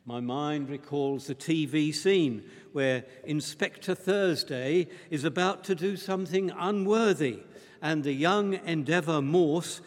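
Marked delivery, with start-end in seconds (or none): none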